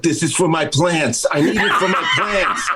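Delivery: hammed-up plea about his plants; mock crazy neighbor. Man to neighbors, laughing about his plants. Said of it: "saying stuff like".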